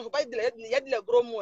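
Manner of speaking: ordinary delivery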